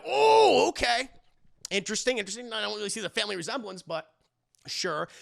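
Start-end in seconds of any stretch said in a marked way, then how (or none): 0.0-2.5 high squeaky skeptical oh, okay; sarcastic oh. Retold or imitated. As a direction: foster parents hearing Machiko claim to be Hannah's mother, mock skeptical. Foster parents' skeptical reaction.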